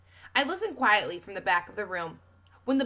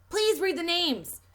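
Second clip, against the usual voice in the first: higher and slow, overly polite plea; 10 semitones higher, 4 dB louder, slower than usual. A narrator's reading. Connor pleading politely with the teacher, polite. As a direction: ask sweetly, on best behaviour. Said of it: "as politely as I've ever heard him".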